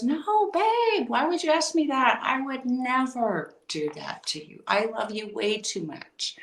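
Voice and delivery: high, sweet imitation of the husband; husband's denial. Husband assuring wife he'd never cheat, mock sweet and high. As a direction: play the husband, all innocent. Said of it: "he looked me straight in the eyes and he goes".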